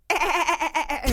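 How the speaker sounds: high mock creepy cackle; a creepy skull-on-a-stick laugh. Mimicking the skull's creepy laugh, high and eerie. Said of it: "like a creepy laugh".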